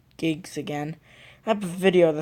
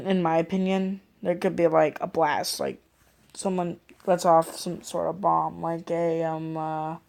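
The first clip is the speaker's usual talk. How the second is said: ordinary talk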